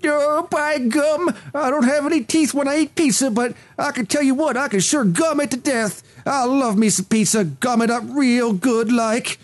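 gum the words like a toothless prospector; old prospector. Pretend. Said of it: "he looks like one of these old toothless prospectors".

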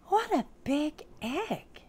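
high, slow elf voice full of wonder; high-pitched acted astonishment; amazed little elf. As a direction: marvel at it like a storybook elf. Elf marvels at found egg.

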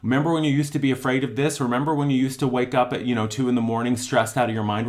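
the fearful inner voice pestering with memories; nagging fearful mind. Retold or imitated. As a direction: needle yourself with old worries. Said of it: "the mind is saying".

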